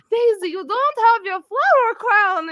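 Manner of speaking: high, drawn-out cooing concern; fussing over pet. Acted. Grendel petting the donkey she grew up with, fussing. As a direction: fuss slowly over a beloved animal. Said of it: "She goes".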